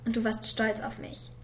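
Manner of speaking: ordinary delivery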